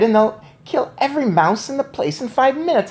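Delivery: high excited outburst; boy cries out his cat plan; eager cry. A narrator's reading. High eager cry. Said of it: "I cried".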